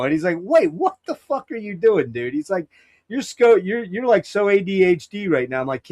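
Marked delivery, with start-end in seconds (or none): 0.4-2.3 high, stunned disbelief from the buddy; mock the friend's shocked reaction; incredulous friend. 3.1-5.6 high-pitched mock of a buddy ribbing him; teasing friend.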